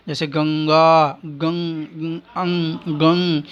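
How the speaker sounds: गंग very slow, the अं hummed through the nose; nasal anusvara demo. Shown as a demonstration. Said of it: "ग के ऊपर जो अनुस्वार". (गंग में अं नाक से, बहुत धीरे)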